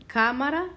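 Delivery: "cámara" as CÁ-ma-ra, rising; stress on first syllable. Shown as a demonstration. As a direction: punch CÁ in "cámara", then lift the end. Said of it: "listen to where we hear the stress".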